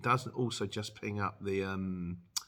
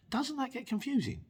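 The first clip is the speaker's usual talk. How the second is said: puzzled. Real, not acted. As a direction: question jumping up high. Puzzled by Brum usage.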